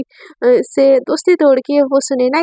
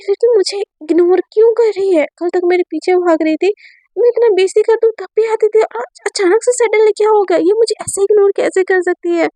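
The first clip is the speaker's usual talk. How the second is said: higher, aggrieved and disbelieving; indignant complaint; 6 semitones higher than usual. Acted. Teacher fretting over being ignored, indignant and higher. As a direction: demand why she ignores you.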